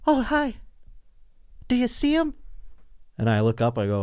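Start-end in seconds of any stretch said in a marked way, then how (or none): 0.1-2.3 much higher, sweet and eager; high eager neighbor greeting; imitating neighbor. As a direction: chirp it like an overeager neighbor in the dark. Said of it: "she is like".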